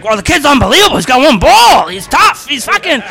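high, loud hyped-up imitation of local fans; excited Boston townsfolk. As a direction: rave like the whole town is hyped. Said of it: "all the town, he's like".

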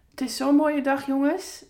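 high, loud burst of delight; beaming with joy; excited. Real, not acted. Joyful exclamation to listeners.

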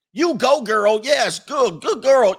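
high, loud hammed-up cheering; mock cheerleading. Commenters cheering on a woman's post, mock cheerleading. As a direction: whoop encouragement like an overexcited supporter.

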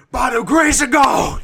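slow, high, loud preacher shout; mock preacher shout. Friend joining the preacher mockery, booming shout. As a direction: proclaim it like a televangelist.

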